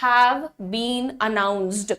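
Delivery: very slow, word by word; slow model sentence. Shown as a demonstration. Teacher modelling exam-date question slowly. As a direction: break it up slowly. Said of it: "इसे आप पूछ सकते हैं".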